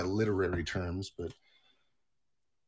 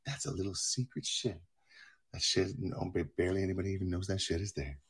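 soft, hushed, soothing painting-show voice; Bob Ross; 2 semitones lower, 7 dB quieter than usual. Retold or imitated. Painter adding happy little trees, soft and soothing. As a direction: murmur gently as you paint the scene. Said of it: "you put on some Bob Ross".

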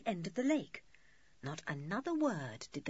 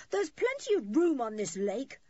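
put-on raised, loud boy's protest; indignant shout; 6 semitones higher, 6 dB louder than usual. A narrator's reading. Julian protests to the couple in the boat, shouting. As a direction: shout it across the water. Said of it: "shouted Julian".

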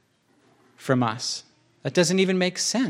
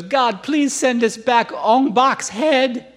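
high, slow, hammed-up wailing plea; mock crying prayer; 8 semitones higher, 3 dB louder, slower than usual. Movie woman on the ground begging God for the idol's head, mock wailing. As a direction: imitate a weeping woman begging heaven, drawn out. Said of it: "She's crying and she's on the ground".

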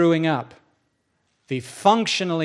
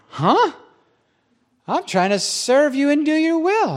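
high, loud, taken-aback objection; indignant protest; 9 semitones higher, 4 dB louder than usual. Acted. Preacher defends his service to God, indignant. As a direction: shoot up high in mock affront.

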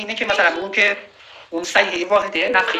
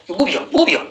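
imitating insolent defiance; 2 semitones higher than usual. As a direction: act out a cheeky challenge. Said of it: "این خیلی جسارت". (تقلید گستاخانه و مبارزطلبانه)

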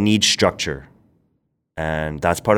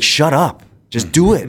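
high, loud brotherly snap of shut up; mock brother yelling; 10 semitones higher, 5 dB louder than usual. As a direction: bark it like an impatient big brother. Brother pushing him to launch.

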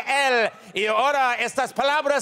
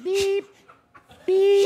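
high, loud mimicked beeps; steady monitor beeps; 8 semitones higher, 3 dB louder than usual. Host signals the surgery worked with high, loud beeps. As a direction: beep like a calm heart monitor. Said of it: "the beeping becomes normal again".

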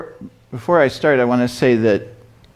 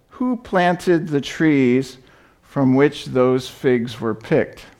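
slow pointed question; 3 semitones higher, much slower than usual. Acted. Old man's pointed wise question.

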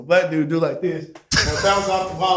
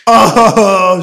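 loud, drawn-out "oh"; retold alarm; 3 semitones higher, 11 dB louder than usual. Man telling his friend about the cell chaos, loud alarm. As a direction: stretch it out at full volume.

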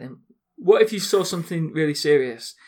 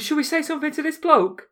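put-on higher, anxious kid's question; imitating the kid; 11 semitones higher, 4 dB louder than usual. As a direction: do the little lad, worried. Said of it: "And he goes".

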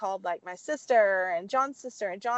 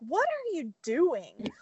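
slow, high, incredulous question; baffled mom; 6 semitones higher, slower than usual. Retold or imitated. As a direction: imitate a suspicious mom, drawing it out. Puzzled mom questions repeated calls.

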